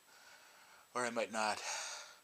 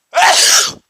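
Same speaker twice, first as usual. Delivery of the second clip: huge high explosive sneeze; gigantic sneeze; 25 dB louder than usual. Real, not acted. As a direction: sneeze as hard as you can. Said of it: "Oh, that was gigantic".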